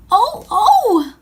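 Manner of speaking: sudden high, loud gasp of alarm; startled cry. Acted. Girl crying out in surprise to the others. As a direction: cry out as something startles her.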